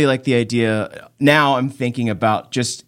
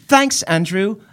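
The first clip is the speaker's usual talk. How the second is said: put-on higher, prim British kid voice; posh British child; 6 semitones higher, slower than usual. Host thanking listener Andrew for the email, posh British child voice. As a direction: praise it as a posh little Brit. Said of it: "Posh British child".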